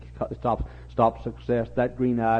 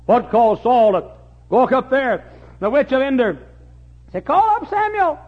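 full-force preaching; 11 dB louder than usual. Acted. Preacher crying out prayers for the young, impassioned. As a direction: pour it out like a desperate prayer.

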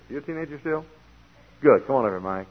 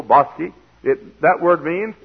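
ordinary delivery

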